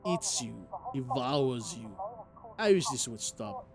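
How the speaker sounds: usual speaking voice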